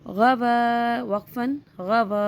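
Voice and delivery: गवा with the final वा held long and level; stretched recitation drill. Shown as a demonstration. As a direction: draw out the ending of गवा both times. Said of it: "हम इनको खींच रहे हैं". (गवा का वा खींचकर)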